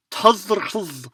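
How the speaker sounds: Tuzrhuz read from the transcription with almost no vowels; Mongolian pronunciation. Shown as a demonstration. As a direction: sound out Tuzrhuz as clusters, high. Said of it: "see how to pronounce that".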